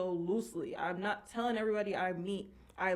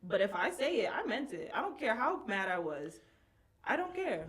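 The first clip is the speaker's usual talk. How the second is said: ordinary talk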